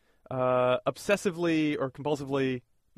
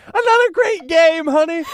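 high loud slow cheery; sweet sing-song praise; cheerful tube spouse; 12 semitones higher, 7 dB louder than usual. Pretend. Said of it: "they're like".

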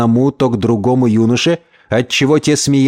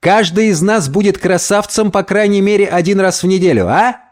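high-pitched, enthusiastic pitch to pals; animated schemer, voice raised in pitch; excited Gomez; 9 semitones higher than usual. Acted. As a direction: sell them the shared dream.

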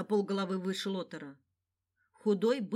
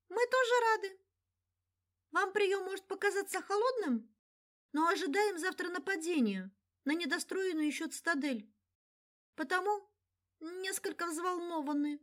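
much higher, deliberately sweet elf; 10 semitones higher than usual. Acted. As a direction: coo it like a cute elf girl. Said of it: "нарочито милым эльфийским голоском".

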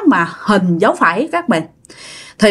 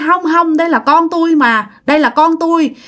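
wailing insistence; 7 semitones higher than usual. Retold or imitated. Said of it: "bà Tân cứ gào".

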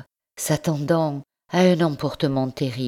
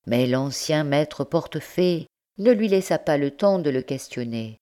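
ordinary delivery, nothing marked

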